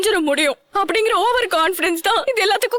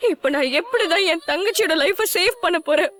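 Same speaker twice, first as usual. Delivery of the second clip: ordinary talk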